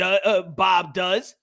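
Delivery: ordinary talk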